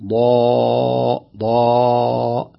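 ضا drawn out very slowly, heavy and open; slow heavy demo. Shown as a demonstration. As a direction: stretch the heavy ضا. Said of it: "لا".

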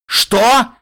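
loud, high bellowed «Что»; roaring. A narrator's reading. Wounded Spike roaring in disbelief at Littlepip's request. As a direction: roar it in shock. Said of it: "взревел Спайк".

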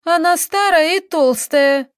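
high, loud spiteful wail; jealous shouting, drawn out; angry cry. A narrator's reading. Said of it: "закричала Таисия, разбрызгивая злые слёзы".

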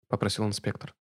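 ordinary delivery

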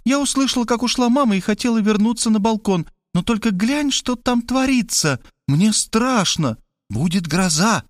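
put-on high, scared girl, slowing down; frightened Kristina. A narrator's reading. Kristina frightened of the storm, telling Robbie, high and scared. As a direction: tremble about the coming storm.

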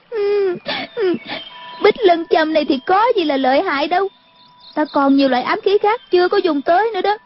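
put-on high, whimpering girl's voice; sob, then whine defiantly in a high pitch; still crying. A narrator's reading. Crying girl brags of her hidden weapons, tearfully. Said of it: "Cô gái vẫn khóc".

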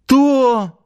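very high startled exclamation; acted flabbergasted outburst; taken aback. A narrator's reading. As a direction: blurt it out, thrown for a moment. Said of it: "На мгновение растерялся начальник гарнизона".

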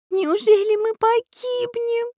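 high, slow, drawn-out wail; tearful. A narrator's reading. Girl fears they will drown, tearful. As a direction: wail it slowly, pitched very high. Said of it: "заплакала Настенька".

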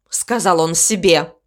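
plain reading voice

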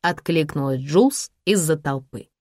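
plain reading voice

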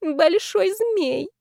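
high, slow, lovestruck drawl; swooning, stretched-out high voice; dreamy. A narrator's reading. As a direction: savour it in a tipsy reverie. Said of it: "мечтательно прикрыв глаза".

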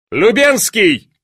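high, loud call for an aide; imperious calling out; shouted summons. A narrator's reading. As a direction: shout the name across the hall. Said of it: "закричал великий князь".

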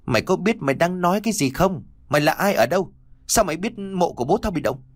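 high, sharp irritated questioning; frowning demand. Acted. Lady questioning the boy about her father's grave, high and irritated. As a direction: grill him with suspicion. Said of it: "bà cau có hỏi".

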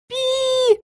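tiny, very high squeak; mouse squeak. A narrator's reading. Mouse on her shoulder backing him, high squeak. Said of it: "поддержал его мышь".

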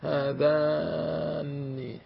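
هذان drawn out very slowly, each sound held; slow recitation demo. Shown as a demonstration. Teacher slowly recites هذان for the class, drawn out. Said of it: "إن هذان لساحران".

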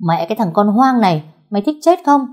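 loud, rising snarl of a bully; angry threat. A narrator's reading. Gang boy turning on the rescuer, angry threat. Boys attack the one who intervened.

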